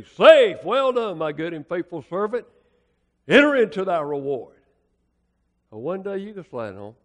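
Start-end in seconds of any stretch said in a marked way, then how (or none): 0.0-4.6 joyful verdict. Retold or imitated. Heavenly umpire welcomes the faithful, loud joyful call. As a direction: proclaim it like a glad judge. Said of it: "the umpire's gonna look at you and say".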